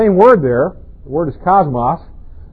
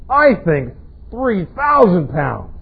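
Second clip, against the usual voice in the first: put-on stubborn younger child; 3 semitones higher, much slower than usual. Kids arguing in van.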